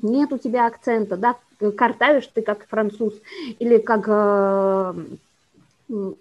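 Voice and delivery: ordinary talk, nothing marked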